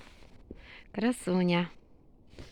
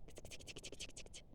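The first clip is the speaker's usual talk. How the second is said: whispered ks-ks-ks; kitty call; 24 dB quieter than usual. Real, not acted. Owner calling the cat with a soft ks-ks. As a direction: hiss softly to call the cat.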